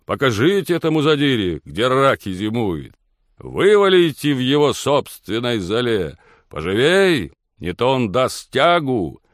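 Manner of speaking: loud, high egging-on; rowdy shouting. A narrator's reading. Friends urging the lord to fight, rowdy shouts. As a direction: goad the fighter on, stretching the ends. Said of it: "кричали они".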